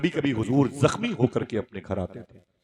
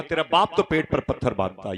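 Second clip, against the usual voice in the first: loud, raised-pitch mocking jibe; retold taunt; 5 semitones higher, 6 dB louder than usual. Intruder mocking the girls about their hungry father, loud taunting. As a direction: throw it out as an imitated sneer.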